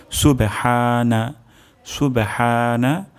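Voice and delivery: সুবহানা very slow, with the হা from mid-throat. Shown as a demonstration. Teacher drawing out সুবহানা so students hear the হা. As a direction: stretch সুবহানা, breathing the হা from the throat. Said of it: "হা গলার মাঝখান থেকে উচ্চারণ হবে".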